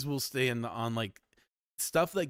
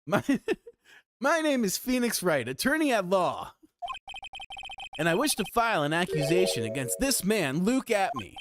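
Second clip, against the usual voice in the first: bold, raised declaration; confident lawyer; 7 semitones higher than usual. Acted. Lawyer formally accusing the detective, bold. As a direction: announce it to the whole court. Voicing Phoenix Wright.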